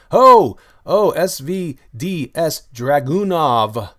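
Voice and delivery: loud, drawn-out hail to a donor; big whooping announcement; excited shout-out. Real, not acted. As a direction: boom out each letter slowly and high. Hailing a viewer's donation.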